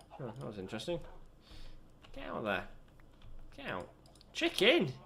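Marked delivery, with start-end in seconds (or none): 2.1-4.9 sharp high yell at a chicken; calling out. Real, not acted. Player yelling at an escaping chicken, high sharp yell. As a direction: shout the bird off in surprise.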